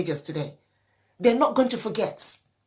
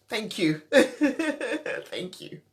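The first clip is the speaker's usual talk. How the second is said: laughing thanks; 3 semitones higher, much slower than usual. Real, not acted. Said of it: "I didn't know I'd be this emotional".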